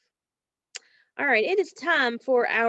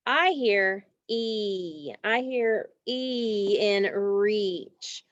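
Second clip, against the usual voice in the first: very slow, each word spaced out; slow model answer; much slower than usual. Shown as a demonstration. Teacher to class, slowly modelling the answer. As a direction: spell the answer out slowly for the kids. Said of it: "teacher models answer".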